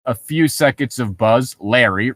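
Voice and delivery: usual speaking voice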